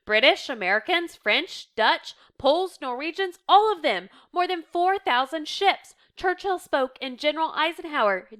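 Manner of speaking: loud, high, emphatic roll call; excited report. A narrator's reading. Reader voicing a helper listing the allies, excited. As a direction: reel off the great news proudly.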